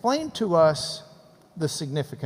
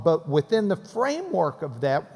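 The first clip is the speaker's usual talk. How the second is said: usual speaking voice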